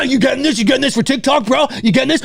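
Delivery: hammed-up bro asking to be filmed; douchebag buddy. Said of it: "And then there's".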